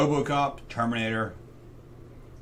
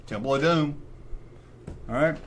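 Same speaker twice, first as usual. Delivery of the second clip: usual speaking voice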